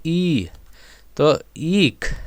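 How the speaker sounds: ई held long, then ईख with the same long vowel; teacher sounding out a drawn-out ई, ईख; long vowel demo. Shown as a demonstration. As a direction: stretch the ई in ईख. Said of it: "ई का sound लंबा आएगा".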